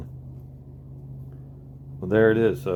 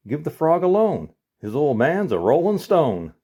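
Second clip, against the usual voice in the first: louder punchline, slowing at the end; manager's punchline; 7 semitones higher, 5 dB louder than usual. Acted. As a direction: land the punchline with a grin. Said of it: "He says".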